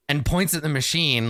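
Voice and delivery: ordinary talk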